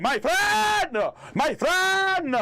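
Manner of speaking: very high screamed greeting; screaming. Shown as a demonstration. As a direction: scream it at the top of your lungs. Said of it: "What if I scream".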